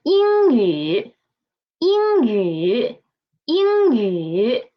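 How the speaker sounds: 英语 said clearly for the class to repeat; model pronunciation. Shown as a demonstration. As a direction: model 英语 slowly. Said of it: "Read after me, please".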